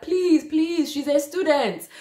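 mum's high-pitched begging, acted out; imitated pleading. Mum begging the bailiff over loudspeaker, imitated high pleading. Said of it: "pleading with him".

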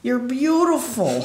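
high, loud strained imitation of a hard-of-hearing man; deaf man shouting. Voicing deaf David Lynch character.

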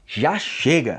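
ordinary delivery

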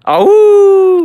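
high, loud drawn-out howl; wolf howl. Pretend. Streamer howling as the dog Wolfie, high playful howl. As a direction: howl out a long, rising "ooh". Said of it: "Wolfie says".

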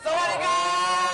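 high, loud cheerful hello; excited greeting. Real, not acted. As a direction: call out the greeting brightly. Host greets guest loudly.

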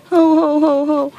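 hammed-up "hu hu" crying; mock boo-hoo. Girl whose money fell in the river, mock wailing.

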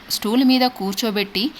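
usual speaking voice

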